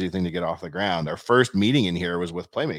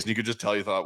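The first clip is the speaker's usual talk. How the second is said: normal talking voice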